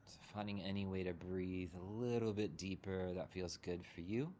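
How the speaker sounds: unhurried meditation guide, long pauses between phrases; guided meditation. Real, not acted. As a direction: guide them gently into settling, leaving room to breathe.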